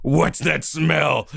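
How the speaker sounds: wrestler voice. Acted. Wrestler voice continues.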